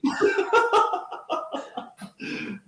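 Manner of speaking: breathless high-pitched laugh; high breathy laugh. Real, not acted. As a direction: burst out in airy laughter. Cohost's rant lands.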